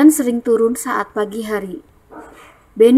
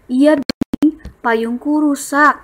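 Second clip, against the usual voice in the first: higher, lively voice as Edo; reading dialogue; 5 semitones higher, slower than usual. Shown as a demonstration. Teacher reading Edo's reply to class. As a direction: answer with a touch of regret. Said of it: "Bacanya dengan intonasi yang tepat".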